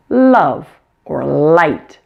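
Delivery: slow "love" and "light", each opening on a light held L; front-of-tongue L. Shown as a demonstration. Teacher shows L at the beginning of words, "love" and "light" with front-tongue L. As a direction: linger on the first L of "love" and "light". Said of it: "just the front of your tongue".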